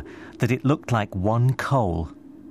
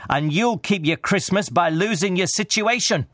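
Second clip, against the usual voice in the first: indignant, raised-pitch warning; angry threat; 9 semitones higher than usual. A narrator's reading. Scrooge threatens his clerk with sacking, angry. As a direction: drive the threat home sharply.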